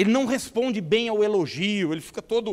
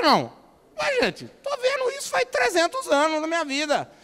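normal talking voice

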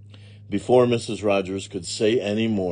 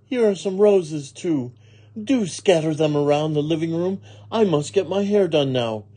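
higher-pitched lady of the house; brisk employer giving orders; 9 semitones higher, 5 dB louder than usual. Acted. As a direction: voice a mistress listing chores. Story dialogue, employer instructs.

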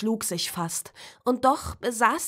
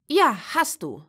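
put-on confirming child; 5 semitones higher, 5 dB louder than usual. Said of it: "sagte Nina bestätigend".

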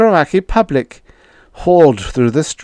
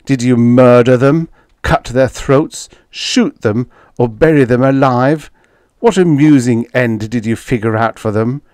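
accusing interrogation; 5 semitones higher, 7 dB louder than usual. A narrator's reading. Retief's harsh interrogation of the captors.

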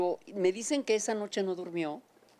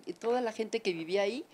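ordinary talk, nothing marked

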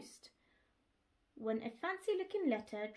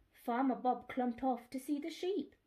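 ordinary delivery

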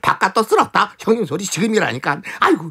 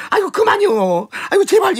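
high pleading cry; 12 semitones higher than usual. Acted. Servant begs to stop.

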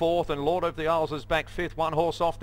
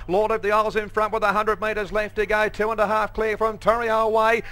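rising, louder race call to the line; race caller; 5 semitones higher, 5 dB louder than usual. Real, not acted. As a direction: call the finish with building excitement. Race caller building to the finish.

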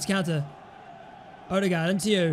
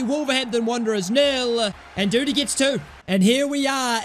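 ordinary talk, nothing marked

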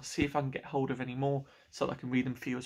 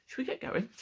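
ordinary delivery, nothing marked

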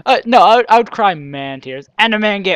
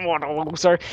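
lightly voiced dorky nerd; 4 dB quieter than usual. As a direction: put on a mild nerdy sneer. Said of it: "like, nerd voice".

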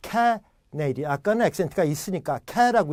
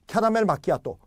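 Americans imitating a Korean accent, higher; attempted Korean accent; 6 semitones higher, 3 dB louder than usual. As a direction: try caramel macchiato with Korean accent, pitched up. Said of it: "흉내를 내는데 안 돼".

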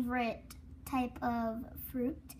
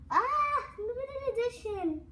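delighted; 8 semitones higher than usual. Real, not acted. Last gush over the prize.

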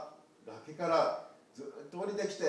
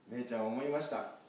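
ordinary delivery

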